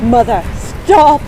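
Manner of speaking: loud, high slowed scream. Acted. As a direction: scream at the mother to stop. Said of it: "Gothel screamed".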